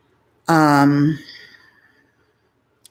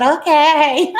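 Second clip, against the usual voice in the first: bright, much higher, louder eager okay; flirty okay; 11 semitones higher, 7 dB louder than usual. Retold or imitated. Answering the waiter's invitation, thrilled flirty okay. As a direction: say yes like you can't believe your luck. Waiter asks to talk later.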